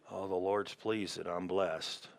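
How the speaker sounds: low, very soft, reluctant recital; barely-voiced acted declaration; half-hearted quiet confession. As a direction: say it small and low, as if it's hard to claim. Voicing someone struggling to say it.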